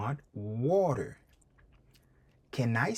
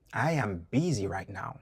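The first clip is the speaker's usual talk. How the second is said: ordinary talk, nothing marked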